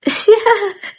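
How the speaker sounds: a bright burst of laughter; high laugh. Real, not acted. Laughs at husband.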